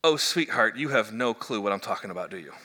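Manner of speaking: low, soft patronizing sweetheart; condescending sweetness. Shown as a demonstration. Pastor explaining the phrase, gently condescending. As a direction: mildly talk down to them kindly. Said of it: "kinda like saying".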